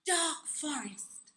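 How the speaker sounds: normal talking voice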